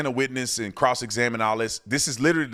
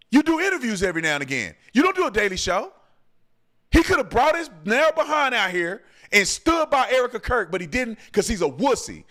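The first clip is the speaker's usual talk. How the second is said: getting heated; 5 semitones higher than usual. Real, not acted. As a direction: vent hard, voice climbing as the anger boils over. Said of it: "Oh, I'm getting heated".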